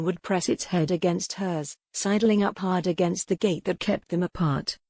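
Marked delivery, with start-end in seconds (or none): none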